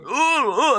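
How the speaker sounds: high mock gagging; mimicking the daughter's fake vomiting sounds; imitated retching. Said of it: "I hear her going".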